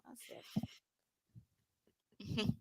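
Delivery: breathy unvoiced whisper. Real, not acted. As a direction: lean in and whisper it. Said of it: "I did it in my, my whisper voice".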